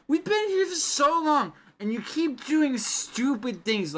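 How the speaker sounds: put-on very high, loud, tearful drunk rant; drunken rant. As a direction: rail at them, nearly crying. Drunk rants about time loop.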